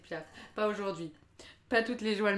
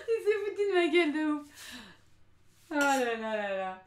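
high, loud, breaking into laughter; spoken through loud laughter; laughing; 5 semitones higher, 4 dB louder than usual. Real, not acted. Laughing while retelling.

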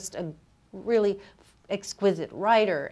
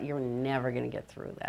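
inner voice; 6 semitones lower, 8 dB quieter than usual. Retold or imitated. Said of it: "and I thought".

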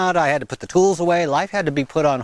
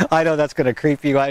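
usual speaking voice

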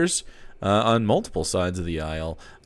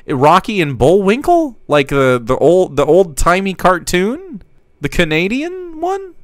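excited; 6 semitones higher, 5 dB louder than usual. Real, not acted.

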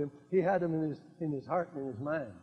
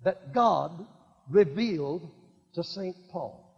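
usual speaking voice

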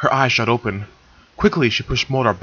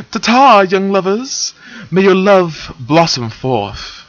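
grand farewell; 8 semitones higher, 4 dB louder, slower than usual. Acted.

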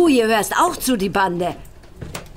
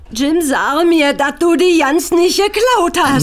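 anxious pleading; 6 semitones higher than usual. Acted. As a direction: beg him to deny it.

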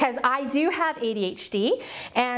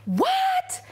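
shocked what. Retold or imitated. Retold reaction to pastor's request.

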